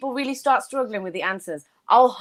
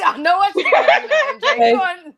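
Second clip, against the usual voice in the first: high laughing words; 10 semitones higher, 5 dB louder than usual. Real, not acted. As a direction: giggle through it. Laughing at friend's cheeky answer.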